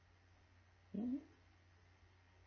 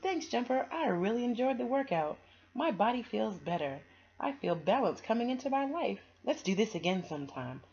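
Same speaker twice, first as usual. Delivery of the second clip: cheerful thanks; 7 semitones higher, 7 dB louder than usual. Acted. Sophie thanking Jumper cheerfully.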